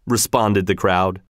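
ordinary delivery, nothing marked

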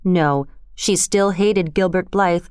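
plain reading voice

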